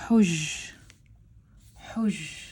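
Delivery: "huj" as one drawn-out syllable; single syllable. Shown as a demonstration. Teacher sounding out part of a word for learners, one syllable. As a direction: say "huj" all at once, holding the final sound. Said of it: "this part all at once".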